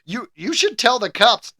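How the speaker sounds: normal talking voice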